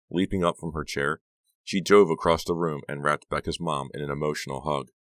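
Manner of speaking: plain reading voice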